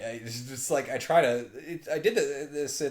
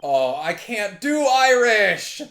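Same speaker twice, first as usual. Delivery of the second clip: loud, high, drawn-out wail; frustrated outcry; 11 semitones higher, 15 dB louder, much slower than usual. Real, not acted. Player despairing at doing an Irish accent, frustrated outcry. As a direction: wail it out, higher and much louder.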